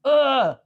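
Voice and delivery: exasperated groan. Retold or imitated. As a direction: groan like a cabbie grudgingly giving in. Retold cabbie giving in.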